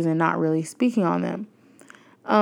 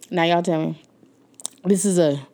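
ordinary talk

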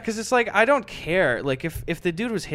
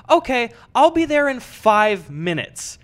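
higher, slower, a bit louder voice; mock eager caller; 7 semitones higher, 5 dB louder than usual. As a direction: stretch it out like an eager fool. Owner's quote, first take.

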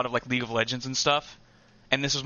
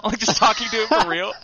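laughing, higher and louder; 7 semitones higher, 5 dB louder than usual. Real, not acted. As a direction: burst out laughing while telling it. Laughing while retelling funny video.

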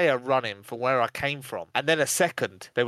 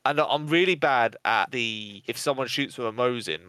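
normal talking voice

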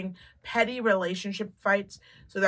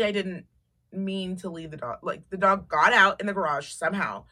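usual speaking voice

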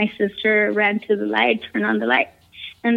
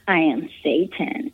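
diabolical voice; 5 semitones lower, 3 dB quieter than usual. Retold or imitated. Possessed friend replies to the pastor, imitated diabolical voice. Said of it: "laughing in the most maniacal, diabolical voice ever".

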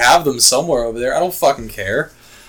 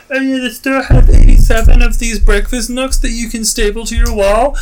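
higher, louder mock-cheery sales voice; mock cheery website; 8 semitones higher, 5 dB louder than usual. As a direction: parody an overeager online store. Mocking the online listing.

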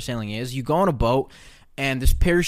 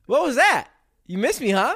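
teasing; 11 semitones higher, 3 dB louder than usual. Real, not acted. Teasing friend about missing him.

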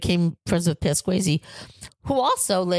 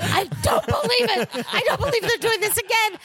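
very high, incredulous mock outrage; retold outrage. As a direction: squeal in exasperated disbelief, hamming it up. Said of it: "I was just so angry".

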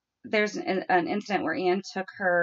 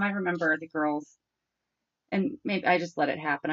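usual speaking voice

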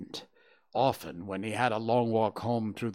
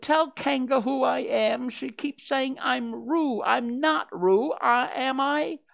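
high, plaintive small voice; crying out in appeal; 4 dB louder than usual. A narrator's reading. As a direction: beg a friend to vouch for you, words stretched out. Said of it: "cried Piglet".

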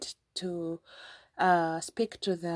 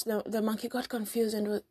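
usual speaking voice

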